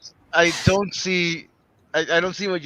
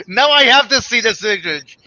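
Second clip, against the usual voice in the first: loud, high, eager voice; excited; 7 semitones higher, 10 dB louder than usual. Real, not acted. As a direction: burst out with glee. Eager to see the picture.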